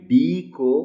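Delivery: डी को sounded like a T. Shown as a demonstration. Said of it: "टी की sound की तरह बोलना है". (डी को टी जैसा बोलें)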